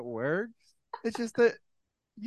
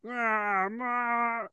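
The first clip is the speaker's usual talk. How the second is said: slow, higher put-on Romano, Muppet-ish; Muppet-like Ray Romano; 5 semitones higher, slower than usual. Trying Ray Romano, going full Muppet. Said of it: "You're going full Muppet".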